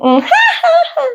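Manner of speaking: very high ha-ha-ha after a hum; put-on girly laugh. Shown as a demonstration. Co-host showing her laugh to a doubter, put-on girly laugh. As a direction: demonstrate a squealed movie-girl laugh. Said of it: "it's more genuine laugh".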